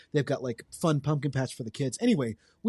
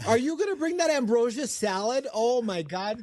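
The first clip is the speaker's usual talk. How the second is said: high, hammed-up Wisconsin gushing; Wisconsin accent; 8 semitones higher than usual. Fussing over ambrosia salad, high Wisconsin accent. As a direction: gush in a Midwestern accent, pitched way up. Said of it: "that's very Wisconsin".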